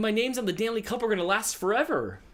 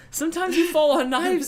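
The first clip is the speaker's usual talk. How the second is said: mock Russian accent; 8 semitones higher, 5 dB louder than usual. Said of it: "It's my favorite Russian quote".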